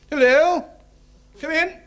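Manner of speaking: high-pitched loud call to the door; calling out brightly. Acted. Solicitor calls to knocker at door.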